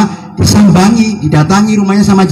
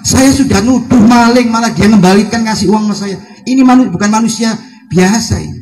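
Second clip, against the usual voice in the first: imitating a startled man, higher-pitched; mildly acted amazement in a raised pitch; astonished; 7 semitones higher than usual. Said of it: "Kaget orang tadi". (menirukan orang kaget, nada meninggi)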